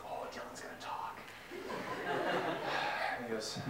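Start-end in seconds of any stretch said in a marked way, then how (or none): 0.1-1.2 whispered like awestruck classmates; hushed awe. Retold or imitated. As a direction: breathe it out as an excited secret. Kids in awe as Dylan rises.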